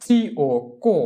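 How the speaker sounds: চি ও, then কো with hard k; spelled-out syllable. Shown as a demonstration. Teacher shows students C plus O, spelled out into কো. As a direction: spell চি ও and blend into কো. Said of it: "তাহলে তার উচ্চারণ হবে".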